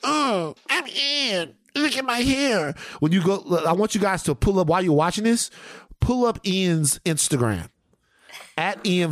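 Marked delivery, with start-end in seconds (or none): 0.0-2.7 high, mocking show-off voice; Ian. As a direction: preen in a silly high voice. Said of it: "This is Ian right here".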